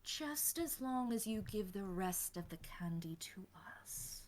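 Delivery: conspiratorial whisper; whispered condition. Acted. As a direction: whisper the catch softly.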